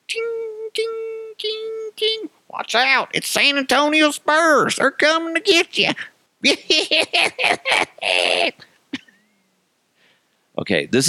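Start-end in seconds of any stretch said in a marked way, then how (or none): 0.1-2.3 tiny high-pitched tings; jingling spur sound. Acted. Comic imitating spurs as he walks around, high soft tings. 2.5-5.9 very high-pitched silly warning voice; mock alarm. Comic warning that the Spurs are coming, in mock high alarm. 6.4-8.5 long high cackling giggle; silly high-pitched laugh. Acted. Comic capping his spurs bit, silly high laugh.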